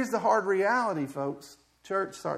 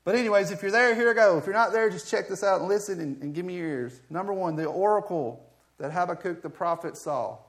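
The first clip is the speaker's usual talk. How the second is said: usual speaking voice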